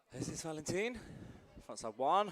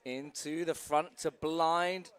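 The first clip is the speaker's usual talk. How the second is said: ordinary talk, nothing marked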